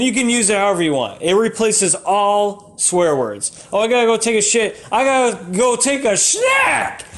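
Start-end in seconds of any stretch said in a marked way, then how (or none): 3.7-7.0 higher, fast, then a shout on snack; mock casual speaker. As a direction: play a guy casually excusing himself. Acted quote using new word.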